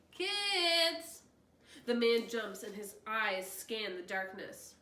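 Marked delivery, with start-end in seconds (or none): 0.1-1.2 loud call, much higher. A narrator's reading. Miss Dickerson calls the kids again, loud. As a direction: call through the dark.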